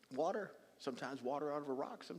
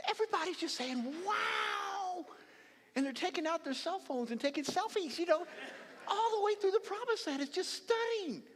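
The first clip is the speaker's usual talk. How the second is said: high, loud, delighted wonder; rising joy as he laughs; excited, laughing telling; 12 semitones higher, 5 dB louder than usual. Real, not acted. Preacher laughing as he pictures the crossing, excited.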